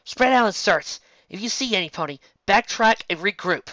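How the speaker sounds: loud, high barked orders; a leader ordering the ponies to search; commanding order. Acted. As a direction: command the team to spread out.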